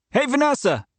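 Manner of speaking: called out high and loud across the room; calling out. A narrator's reading. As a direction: call Vanessa over from the next room. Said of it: "Devin called".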